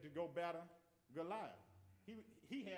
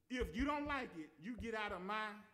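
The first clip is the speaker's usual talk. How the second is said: higher, louder retold parent's order; raised-voice mock parental warning; parental ultimatum; 5 semitones higher, 5 dB louder than usual. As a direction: lay down the law, imitating a stern parent. Retold parents laying down the law.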